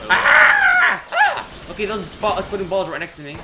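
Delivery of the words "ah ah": sudden high shrieks of fright; startled screams. Real, not acted. Gamer shrieking at exploding balls next to him, high screams.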